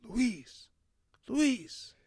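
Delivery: high, far-carrying call of a name. Retold or imitated. Coach calling out to a former student across the quad, high and carrying. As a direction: call across the quad to get his attention.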